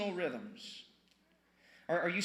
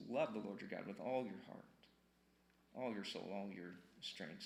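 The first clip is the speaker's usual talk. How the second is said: "Love the Lord your God" much softer and lower; soft scripture; 8 semitones lower, 11 dB quieter than usual. Shown as a demonstration. Quoting Moses to the church, much softer. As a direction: breathe "all your strength" almost hushed. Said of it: "Moses says".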